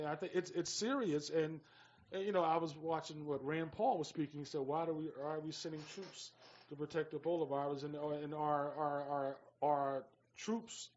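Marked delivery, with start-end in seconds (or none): none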